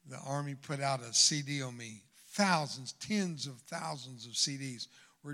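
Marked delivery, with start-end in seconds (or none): none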